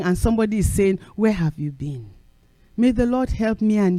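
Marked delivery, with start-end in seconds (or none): 1.2-2.1 soft question. Retold or imitated. Someone quietly asking where you have been.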